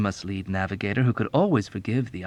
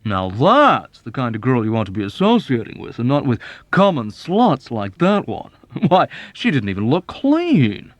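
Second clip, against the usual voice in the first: louder, condescending officer voice; smug Arfie; 2 semitones higher, 6 dB louder than usual. Acted. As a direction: lecture him like a pompous uncle. Arfie lecturing Nately.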